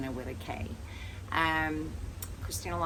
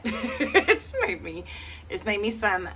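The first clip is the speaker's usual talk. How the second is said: laughing; 7 semitones higher, 6 dB louder, slower than usual. Real, not acted. Laughing over small earnings.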